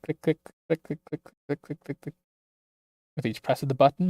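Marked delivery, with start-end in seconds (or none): 0.0-2.1 fading clicks. Acted. Pressing the button over and over.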